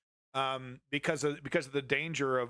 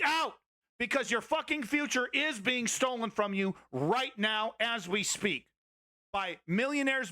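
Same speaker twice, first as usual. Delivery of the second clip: much higher, forceful, listing enemies; heated shout; 6 semitones higher than usual. Real, not acted. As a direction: demand they freak out. Shouting at viewer to freak out.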